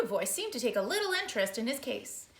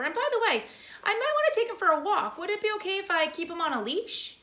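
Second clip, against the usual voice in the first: Mister Popper; 10 semitones higher, 4 dB louder than usual. Acted. Mr Popper voiced higher.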